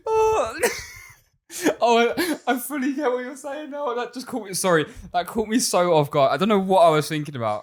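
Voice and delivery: high-pitched, helpless real laughter; crying with laughter. Real, not acted. Podcaster caught off guard by co-host's joke, crying with laughter. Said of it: "I've literally been crying".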